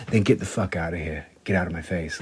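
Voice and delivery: a hint of a cold rock star; low quick "get out of my face"; low mock dismissal.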